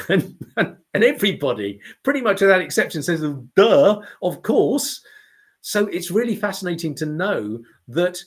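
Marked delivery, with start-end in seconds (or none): 3.6-5.0 mocking duh. Everyone answering the obvious.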